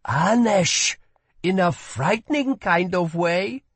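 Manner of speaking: put-on a curious young woman asks; girl's voice. A narrator's reading. Girl objects.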